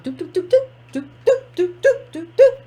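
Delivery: high little "doop" bounces; quick, light, high-pitched bouncing noises; bouncing sound effect. Retold or imitated. Host mocking a candidate dancing on her toes, bouncing doops.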